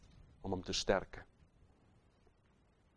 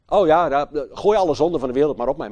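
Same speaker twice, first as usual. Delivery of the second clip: eager acceptance; 4 semitones higher, 9 dB louder, faster than usual. Acted. Jesus voiced accepting the sins.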